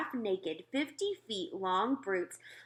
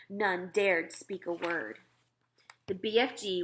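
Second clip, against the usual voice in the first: ordinary delivery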